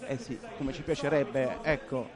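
usual speaking voice

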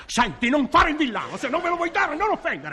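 comic angry telling-off; angry scolding. Acted. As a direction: tell him off sharply. Quarrel escalates.

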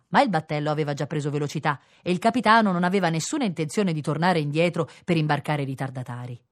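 ordinary delivery

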